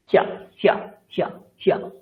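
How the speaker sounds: হিয়া said as hi-ya, the ya clearly split off; Sanskrit pronunciation drill. Shown as a demonstration. Teacher drilling students on Sanskrit ya-phala, hi-ya. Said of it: "আপনাকে হিয়া বলতেই হবে".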